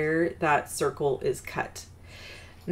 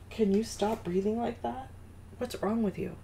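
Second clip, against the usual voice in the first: higher, fond exasperated pet scolding; higher, softer mock-scold aimed at the dog; talking to the dog; 6 semitones higher, 6 dB quieter than usual. Real, not acted.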